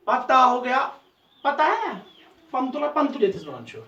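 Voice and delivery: ordinary talk, nothing marked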